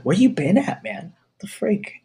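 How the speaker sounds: high-pitched mock exasperation; incredulous teasing. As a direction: scold a friend in high disbelief. Teasing cohost for missing the news.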